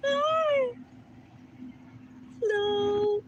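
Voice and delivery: very high, sing-song greeting to animals. Real, not acted. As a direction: coo at the ducks like babies. Cooing at the ducks.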